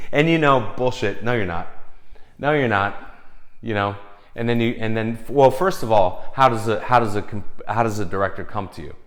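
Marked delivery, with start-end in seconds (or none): none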